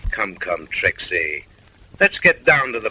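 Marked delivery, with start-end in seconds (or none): none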